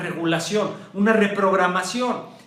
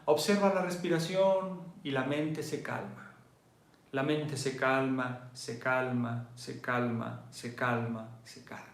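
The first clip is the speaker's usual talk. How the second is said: calm meditation guide; 5 semitones lower, 10 dB quieter than usual. Retold or imitated. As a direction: quote the teacher calming the mind. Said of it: "Entonces él decía". (voz de meditación que se apaga)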